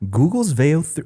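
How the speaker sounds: Google's Veo three said in a plain American accent by mistake; American accent slipping in. Shown as a demonstration. Co-host trying a British accent slips into American accent. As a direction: slide back into an American accent. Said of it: "that's my American accent".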